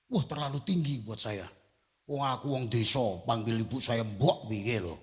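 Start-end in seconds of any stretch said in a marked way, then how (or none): none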